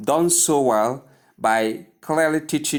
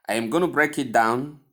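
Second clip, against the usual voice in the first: usual speaking voice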